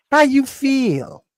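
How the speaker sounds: high, slow, mocking soft brother; soft mock greeting. Mocking a soft brother's greeting, high and slow. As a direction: mince the words out like a softie. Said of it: "Since when do brothers say".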